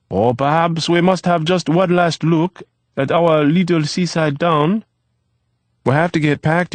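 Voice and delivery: put-on lively boyish chatter from the kitchen; Eve's voice. A narrator's reading.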